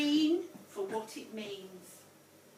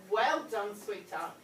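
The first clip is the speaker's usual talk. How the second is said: ordinary talk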